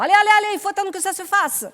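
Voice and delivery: high, loud shooing. Acted. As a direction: chase the boys out briskly. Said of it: "Simone Viau, qui a mis les garçons dehors".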